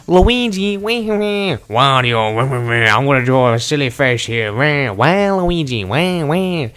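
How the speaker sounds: loud, wailing cartoon Wario and Luigi; cartoon character voices. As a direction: ham up the game characters, stretching every wah. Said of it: "he just got into character".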